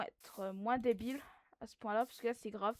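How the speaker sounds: ordinary talk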